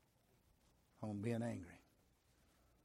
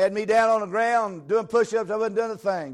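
loud, high preaching shout; shouting preacher; 9 semitones higher, 13 dB louder than usual. Real, not acted. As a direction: shout it from the pulpit. Preacher shouting basic training story.

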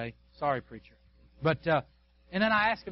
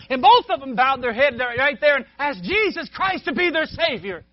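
loud, high, fervent preaching climax; full-force preaching; 9 semitones higher, 11 dB louder than usual. Acted. Preacher telling congregation of two saved, loud fervent preaching. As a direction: lift it to a shout of joy.

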